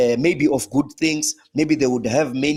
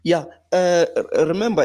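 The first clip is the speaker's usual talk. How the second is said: ordinary talk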